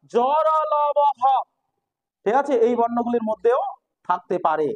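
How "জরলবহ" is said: জরলবহ stretched very slowly letter by letter; drawn out. Shown as a demonstration. Teacher listing the letters slowly for students. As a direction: drag out each letter of জরলবহ. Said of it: "listing letters for students".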